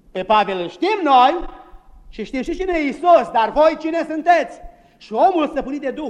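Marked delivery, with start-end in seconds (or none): 0.1-4.6 high, loud mocking challenge; mock them with scorn; ironic. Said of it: "destul de ironic". (răspuns ironic, mai sus și mai tare)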